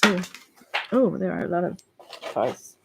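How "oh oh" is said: normal talking voice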